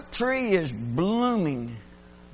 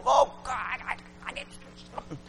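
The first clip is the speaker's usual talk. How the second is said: higher cry into a whisper; desperate plea; 8 semitones higher, faster than usual. Retold or imitated. Preacher retelling his plea to God, desperate whisper. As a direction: beg God breathlessly. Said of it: "I'm going".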